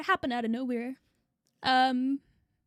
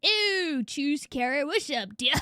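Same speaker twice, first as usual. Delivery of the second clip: normal talking voice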